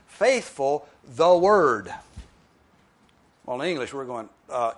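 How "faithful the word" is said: Faithful the word, each word slow and apart; slow literal reading. Shown as a demonstration. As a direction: read "Faithful the word" slowly, word by word. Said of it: "Literally, the original translates".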